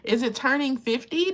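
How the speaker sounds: ordinary delivery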